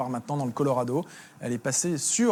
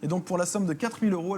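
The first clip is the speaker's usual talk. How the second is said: higher-pitched urgent curator cutting in; museum curator; 5 semitones higher than usual. Pretend. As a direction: burst in to claim it for the museum. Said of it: "Je suis le conservateur du musée de la raquette".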